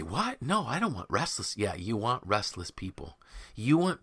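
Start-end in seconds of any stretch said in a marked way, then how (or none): 0.0-1.6 high, swooping mock disbelief; mock incredulous objection. People objecting to restless hires, mock incredulous. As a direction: balk in a higher, swinging voice.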